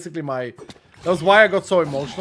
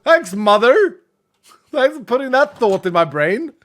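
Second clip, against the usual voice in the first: shrill shocked what the hell, louder and much higher; shocked high outburst; 8 semitones higher, 7 dB louder than usual. Retold or imitated. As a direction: act stunned and outraged. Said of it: "It's like".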